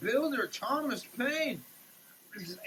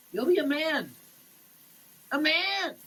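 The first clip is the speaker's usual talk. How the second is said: rising proclamation; 5 semitones higher than usual. Acted. Climax of the Kipling poem.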